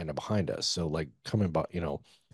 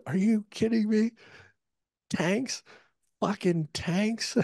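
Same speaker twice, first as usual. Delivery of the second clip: very high-pitched, squeaky outburst of disbelief; mock incredulity; 3 dB louder, slower than usual. J tac reacting to tanks, retold in high-pitched incredulity. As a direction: act out a comrade's rising, stunned questions.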